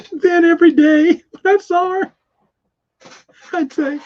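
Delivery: can't hold back the laugh. Real, not acted. Laughing while retelling the daily greetings to listeners.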